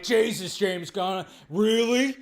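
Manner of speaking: mock indignant fan complaint; mock whiny fan. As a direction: sneer like an outraged fan. Quoting loud fandom complaint.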